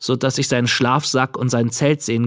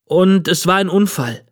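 put-on higher boy's voice, matter-of-fact; truthful boy; 6 semitones higher than usual. A narrator's reading. Boy to the old man he wronged, truthfully explaining. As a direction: add it as a simple truth. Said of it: "fügte ich wahrheitsgemäß hinzu".

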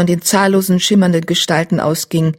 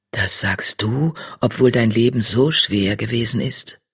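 astonished tom; 6 semitones lower than usual. Acted. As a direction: wonder at her answer. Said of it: "Riesensterns Stimme klang erstaunt".

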